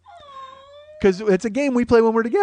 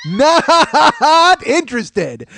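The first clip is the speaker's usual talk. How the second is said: Not stretched into three syllables, slow and loud; slow drawn-out not; 8 semitones higher, 7 dB louder, much slower than usual. Shown as a demonstration. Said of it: "I put three syllables and not".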